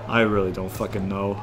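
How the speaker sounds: normal talking voice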